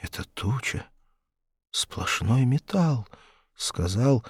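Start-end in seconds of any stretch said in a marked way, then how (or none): 0.0-3.1 unvoiced, slow breathy gasp; breathed out. A narrator's reading. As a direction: breathe the words in shock. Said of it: "выдохнул".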